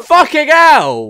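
loud, high, slow amazed outburst; amazed shout. Real, not acted. Friend reacting to the subscriber count, loud amazement. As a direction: drag it out in real astonishment.

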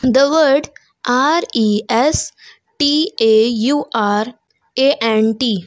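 ordinary delivery

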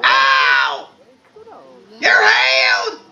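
high-pitched cries, the last drawn out; healing shout. Acted. Priest slapping a patient healed, high drawn-out shout. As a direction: yell it high and stretch it.

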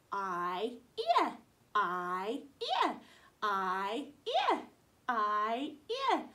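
teacher drilling I sounds; long and short I. Shown as a demonstration. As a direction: swoop up on each "ear". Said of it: "vowel drill".

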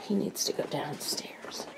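hushed aside, barely voiced; whisper. Real, not acted. Quick aside to the camera about the dog, whispered. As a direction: whisper it so the dog won't hear.

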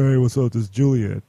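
put-on old-school guy voice. Host imagining guest's old days, old-school guy voice. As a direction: drop the pitch into a guy's hello. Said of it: "back in the old school days".

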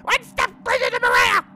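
mock fanatical declaration. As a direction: proclaim it like a zealous cultist. Voicing a game character.

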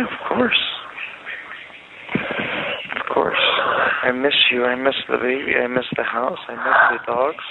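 ordinary talk

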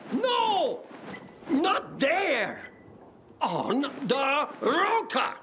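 high, urgent yelling; frantic calls to climb to the rotor; shouted directions. Acted. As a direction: bark orders over the noise. Shouting directions at a bomb carrier.

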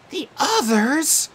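high, slow shocked question; gasped. Acted. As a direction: catch your breath in alarm. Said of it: "Annabeth gasped".

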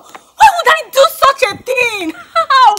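outraged shout. Acted. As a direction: protest the accusation hotly. Accused friend defends herself.

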